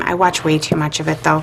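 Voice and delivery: ordinary talk, nothing marked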